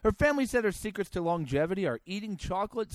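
ordinary talk, nothing marked

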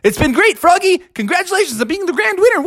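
high, rushed cartoon farewell; Captain Crunch. Captain fleeing into the box, hurried. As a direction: race through it, ending in a whoop. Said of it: "He said".

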